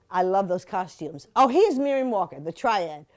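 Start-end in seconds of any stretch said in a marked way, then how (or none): none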